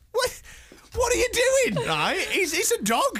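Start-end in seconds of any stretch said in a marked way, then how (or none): 0.1-1.9 high-pitched incredulous demand; exasperated, voice shooting up; mock outrage. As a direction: squawk it in disbelief. Mock outrage at men cooing.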